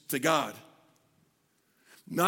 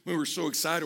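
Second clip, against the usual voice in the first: usual speaking voice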